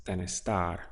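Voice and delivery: ordinary delivery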